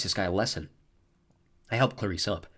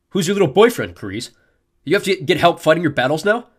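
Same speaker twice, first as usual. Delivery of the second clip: louder sneering taunt; mock her for needing help; bully taunting the girl about her boyfriend; 8 semitones higher, 10 dB louder than usual. A narrator's reading. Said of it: "He sneered".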